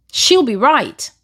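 Aussie catchphrase, lilting and falling; Australian accent. Retold or imitated. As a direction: quote it in an Aussie accent, swooping down at the end. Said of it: "I heard it in their accent".

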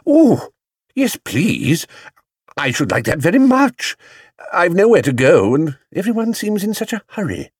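high, eager bear; excited. A narrator's reading. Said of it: "his hat nearly fell off with excitement".

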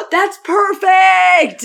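delighted, slow, loud exclamation; excited shout. Real, not acted. Cohost thrilled by the matchup, shouting with delight. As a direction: burst out with glee.

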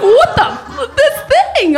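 hammed-up shock at the gift. Wife reacting to husband's giant dildo gift with shock.

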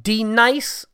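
loud, high "D Nice" for Denise, dee-NICE; mispronounced name. Retold or imitated. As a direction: say "D Nice" as dee plus the word nice. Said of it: "he mispronounces the names".

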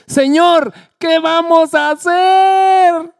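high, slow, drawn-out panicked question; a servant begging his master for help; frightened plea. Acted. Servant cries out to Eliseo, high and slow in panic.

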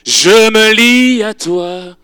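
slow drawn-out prayer over music; slow intimate prayer. Acted. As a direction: linger on each word.

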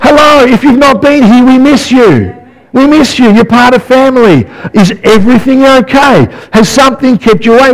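lightly voiced caring welcome, a bit high; a gentle, concerned welcome back; welcoming church greeting. Shown as a demonstration. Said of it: "But, you know, like".

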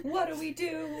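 hammed-up panicked voice; mock panic. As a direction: play a frightened villager.

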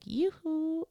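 high, soft singsong hail; playful call. Acted. God calls to forgetful believers, playful singsong. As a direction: call out lightly for someone's attention.

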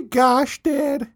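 put-on high whiny teen girl; snotty teenage girl. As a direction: whine it like a bratty daughter. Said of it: "snotty teenage girl".

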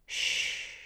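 hushing softly into an ear; soft soothing shush; soothing whisper. A narrator's reading. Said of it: "he was soothing into my ear".

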